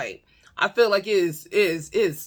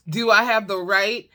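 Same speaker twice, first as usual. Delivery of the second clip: ordinary talk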